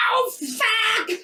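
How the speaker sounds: high, drawn-out howl of pain; mock scream of agony; agonized yell. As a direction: cry out as the hammer hits. Acted victim being nailed to cross.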